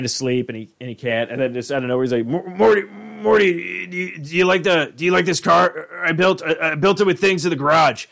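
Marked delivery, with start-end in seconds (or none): 2.3-8.1 high, loud rambling scientist; drunk-sounding eager rambling; Rick voice. Retold or imitated.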